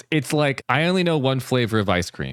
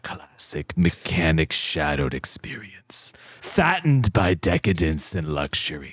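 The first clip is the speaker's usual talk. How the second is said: spooky voice; 4 semitones lower than usual. Acted. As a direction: breathe it out ominously. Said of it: "Do it with a voice".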